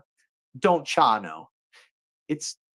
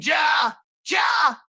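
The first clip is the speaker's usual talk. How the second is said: high hammed-up Ja shouts; mock Viking holler; 11 semitones higher than usual. Vikings stealing a cow, hammed-up high shouting. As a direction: bellow Ja like a raiding Viking. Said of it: "they're already hollering".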